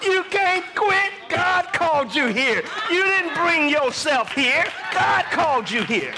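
forceful preacher, climbing high; full-force preaching. Real, not acted. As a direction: drive it home to the congregation, rising in pitch.

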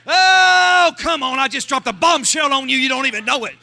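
ordinary talk, nothing marked

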